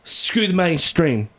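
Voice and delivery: put-on high punky British accent. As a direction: drag it out like a cocky British punk. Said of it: "You know, my British accent".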